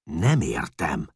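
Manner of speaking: Csát vexed, refusing to understand; annoyed. A narrator's reading. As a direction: grumble it, fed up. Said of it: "bosszankodott Csát".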